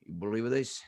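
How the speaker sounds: low, quiet threatening father; a low growled threat to the kid; menacing father. Retold or imitated.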